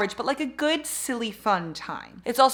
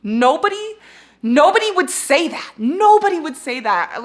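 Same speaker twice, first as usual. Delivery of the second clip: exasperated; 8 semitones higher, 9 dB louder than usual. Retold or imitated. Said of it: "I'm like".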